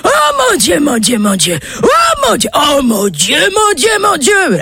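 high ecstatic shouting; shouting oh my God. Acted. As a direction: yell out in rapture. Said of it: "il se met à hurler". (hurlement aigu d'extase)

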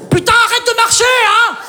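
yelled at upstairs neighbor. Acted. Yelling at neighbor above.

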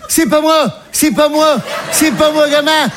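high, frantic mum protesting; imitated mother. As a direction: plead innocence as the high-voiced mother. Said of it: "Et elle était là". (maman imitée qui proteste, voix aiguë)

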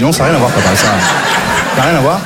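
chuckling denial to dad; laughing protest. Retold or imitated. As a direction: laugh it off, insisting. Protestation au père, en riant. (protestation en riant au père)

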